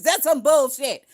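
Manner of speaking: loud scoffing dismissal; scoffing outburst. Real, not acted. Streamer scoffs at the news, loud and disgusted. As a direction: spit it out in disgust.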